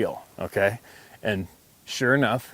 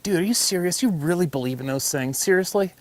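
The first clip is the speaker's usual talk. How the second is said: pitched-up mock disbelief; incredulous quote; 8 semitones higher than usual. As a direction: act out the friend's stunned question, higher. Friend's incredulous retold question.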